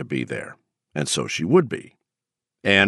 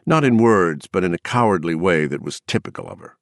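ordinary delivery

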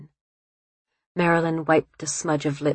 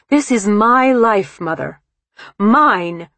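slow, emphatic, raised outburst; shouted defiance; 5 semitones higher, 6 dB louder, slower than usual. A narrator's reading. Daughter jerking free of her mother, shouting defiantly. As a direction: claim it loudly, pitched up on the key words. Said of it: "Marilyn shouted, slamming her fist".

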